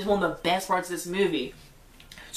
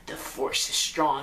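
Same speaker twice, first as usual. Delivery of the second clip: lightly voiced Emperor, lower and slow; a hint of the sinister Emperor; a touch of the Emperor's menace, lower and unhurried; 7 semitones lower, slower than usual. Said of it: "He talks like this".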